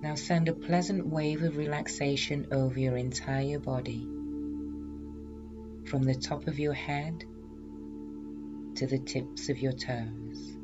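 slow, soft hypnotherapy voice; hypnotic induction voice. Acted. Leads hypnosis relaxation induction.

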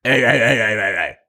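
fast, dismissive imitation; mimicking the host. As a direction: rattle it off, brushing him off. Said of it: "and then, like".

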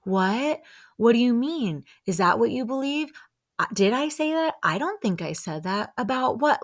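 mock-innocent string of confused questions; acting dumb. Imitating a liar dodging questions, playing dumb. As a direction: play clueless and deny everything, imitating a liar. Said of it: "let me just act dumb".

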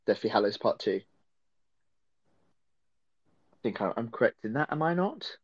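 choppy connection cutting out; breaking up. Real, not acted. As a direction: voice dropping out on a bad line. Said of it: "Oh, you're breaking up".

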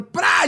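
sudden explosive tearing noise; imitated rip. Waxer rips mid-lecture, imitated rip. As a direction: burst in with a ripping sound.

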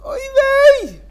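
shrill, very high panicked cry; yiddish accent. Acted. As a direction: yelp in sudden dismay. Wife remembers the cholent.

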